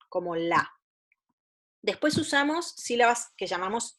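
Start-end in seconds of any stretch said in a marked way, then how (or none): 0.4-0.7 teacher voicing the direct syllable la to her students. Shown as a demonstration. A clear teaching demo of la. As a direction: say la as one clear syllable, consonant then vowel. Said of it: "primero consonante y después vocal".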